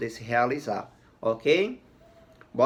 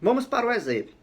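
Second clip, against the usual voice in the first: usual speaking voice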